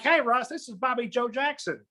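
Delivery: a hint of an older Southern lady's drawl; Ann Richards-like drawl. Regional VP calling, lightly mock Southern drawl. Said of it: "she sounds like the former governor of Texas".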